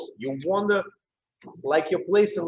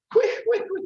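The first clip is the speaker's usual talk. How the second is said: high quick calls. Shown as a demonstration. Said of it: "when you will go into quick".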